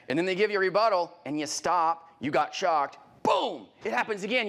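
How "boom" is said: crash. Acted. Crash of stopping mid-script.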